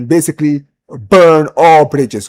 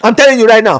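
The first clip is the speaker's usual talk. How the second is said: ordinary talk, nothing marked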